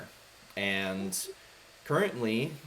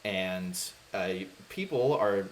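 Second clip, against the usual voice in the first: usual speaking voice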